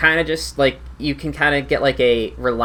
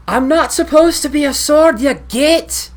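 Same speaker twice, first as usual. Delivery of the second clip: high, loud outraged outburst; indignant talking sword; 12 semitones higher, 6 dB louder than usual. Acted. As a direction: protest loudly as a cursed sword. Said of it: "you'll hear".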